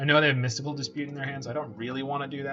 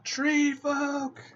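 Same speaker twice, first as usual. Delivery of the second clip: much higher, cartoonish yell of the name; squeaky cry; 13 semitones higher, 3 dB louder than usual. Hyping the card to chat with a squeaky announcement.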